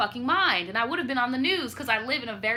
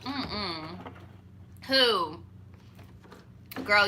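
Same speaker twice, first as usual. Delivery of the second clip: ordinary delivery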